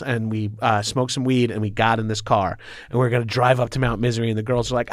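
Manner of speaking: normal talking voice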